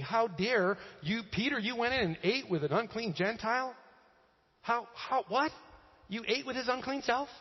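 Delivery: high, incredulous mock outrage; acted indignation. Believers confronting Peter for eating with Gentiles, acted indignation. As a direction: stammer in scandalized disbelief.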